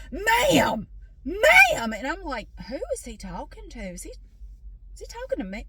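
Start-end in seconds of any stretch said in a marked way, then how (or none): 0.1-1.9 loud, high shout to get attention; big imitated checkout-man shout. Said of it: "shouts at me and he goes". 2.7-5.7 hushed, softer, baffled; quiet bewildered muttering; puzzled aside. Retold or imitated. Baffled aside while being shouted at.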